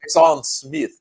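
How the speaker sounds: loud, high, playful French-style name; hammed-up French pronunciation of the surname and first name; mock French accent. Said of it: "it's my French accent".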